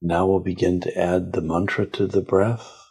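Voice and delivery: slow, calm meditation guide voice; guided meditation. Acted. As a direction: lead them gently inward, unhurried.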